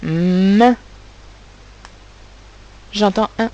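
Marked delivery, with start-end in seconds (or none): 0.0-0.8 M drawn out like a long hum into the vowel; sustained sound. Shown as a demonstration. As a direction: hold the M before opening it up. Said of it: "le mot main".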